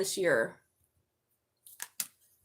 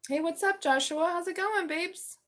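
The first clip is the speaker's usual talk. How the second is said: higher, bright-toned hello to a viewer; sing-song greeting; 9 semitones higher, slower than usual. Real, not acted. A tarot reader greets a viewer, high sing-song hello.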